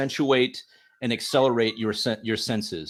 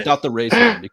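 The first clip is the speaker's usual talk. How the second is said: still amused; 5 semitones higher, 7 dB louder than usual. Real, not acted. Repeats the joke, still amused.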